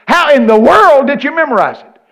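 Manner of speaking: high, hollered incredulous question; exaggerated astonished preacher shout; loud mock amazement. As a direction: holler it at the crowd in disbelief.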